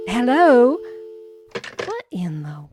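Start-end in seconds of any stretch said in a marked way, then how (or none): 0.1-0.8 loud, rising call into a dead phone line; louder hello. Acted. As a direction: call out loudly, waiting for an answer.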